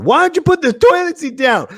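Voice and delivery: high, loud mock yell; nagging partner. Significant other yells about toilet seat, hammed-up nagging. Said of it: "you have a significant other".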